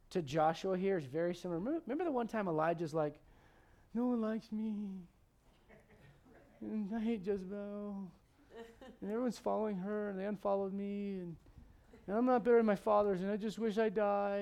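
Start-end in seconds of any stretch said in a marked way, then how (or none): 3.9-4.7 mildly whiny mock moping; mock self-pity. Acting out a moping Elijah. 6.6-8.1 slow, softer mock moan; drawn-out, mopey mock complaint; mock sulking. Elijah's moping, first take. 9.0-14.4 mildly whiny mock moping; mock self-pity. Acting out a moping Elijah.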